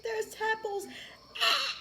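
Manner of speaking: high, crying child's wail, imitated; mock scared cry. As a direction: whimper it like a scared kid. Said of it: "she's like".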